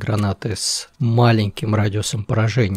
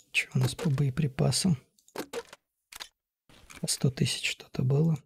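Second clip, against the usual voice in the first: soft whisper; 8 dB quieter than usual. Real, not acted. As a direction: whisper so nobody hears. Whispering during tense raid.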